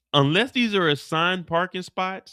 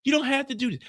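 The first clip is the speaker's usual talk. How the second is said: higher, desperate wait wait; frightened pleading; 8 semitones higher than usual. Acted. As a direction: beg him to stop, pleading.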